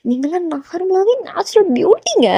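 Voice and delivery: high but light fangirl compliment; gushing insistence. Acted. Fan brushing off Jimin's modesty, gushing. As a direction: praise him in a soft, high lilt.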